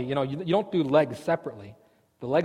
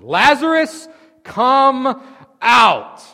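huge, high shouted command; shouting a dead man out of the tomb; 10 semitones higher, 13 dB louder, much slower than usual. Acted. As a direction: bellow it into the grave. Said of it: "he just screams into the opening".

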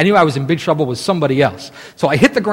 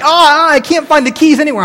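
panicked; 11 semitones higher, 6 dB louder than usual. Retold or imitated. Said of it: "And I'm like".

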